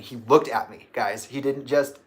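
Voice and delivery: normal talking voice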